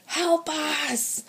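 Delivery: high shout for help; yelling for help. Acted. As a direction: shout to people far away. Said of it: "Tony yelled at them".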